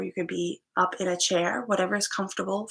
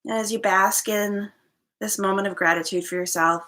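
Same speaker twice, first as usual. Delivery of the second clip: guided meditation. Acted. Yoga teacher leading listeners into gratitude and inward focus, guided meditation. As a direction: settle listeners into stillness, phrase by unhurried phrase.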